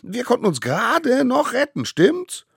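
very high, loud, stumbling squeal; frantic little fibber. Acted. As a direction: gush the lucky escape, seeking backup. Child stammers out tall tale. (aufgeregtes Kind, hoch und laut)

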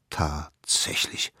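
soft, slow whispered aside; hushed whisper. A narrator's reading. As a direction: breathe it in quiet awe. Hushed surprise at the mammoth.

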